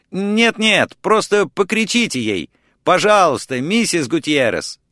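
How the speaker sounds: high, urgent plea over the phone; half-shouted, insistent request; urgent calling. A narrator's reading.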